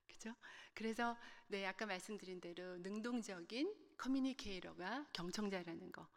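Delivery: usual speaking voice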